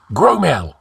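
sharp, high call to a henchman; snapped order. A narrator's reading. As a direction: snap the name out as a command. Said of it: "snapped Ascalante".

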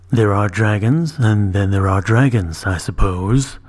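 low, slow, wary dragon voice; tense dragon. A narrator's reading. Dragon speaking warily about other dragons. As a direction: hold back tension under the words. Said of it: "holding himself tense".

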